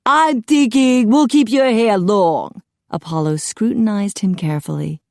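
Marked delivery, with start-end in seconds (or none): 0.0-2.6 high, loud, theatrical musing; musing stylist. A narrator's reading. Apollo decides on Jack's style, high theatrical musing. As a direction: pronounce it like a flamboyant hairdresser.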